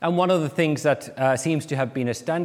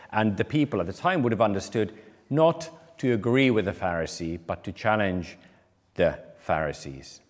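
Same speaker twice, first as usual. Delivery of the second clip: ordinary talk, nothing marked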